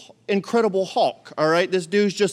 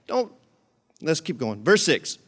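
ordinary talk

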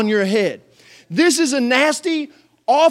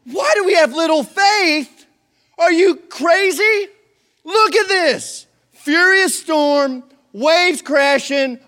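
exasperated rant; 5 semitones higher, 5 dB louder than usual. Retold or imitated. Disciples ranting at Jesus in the storm, exasperated. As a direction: vent at him, exasperated. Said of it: "And they're like".

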